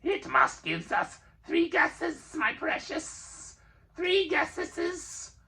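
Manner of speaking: high, slow creature demand; high, slow wheedling creature; Gollum hissing. A narrator's reading. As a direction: bargain greedily. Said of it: "Hissed Gollum".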